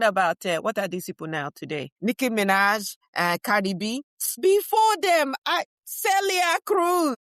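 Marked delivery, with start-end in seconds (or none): 4.4-7.1 proud proclamation. Acted.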